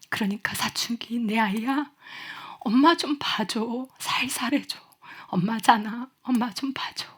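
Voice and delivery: soft, quiet letter reading with a hurt ache; reading a mother's letter. Acted. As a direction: read it as a mother pleading with her teen. (조용히 애원하듯 편지 낭독)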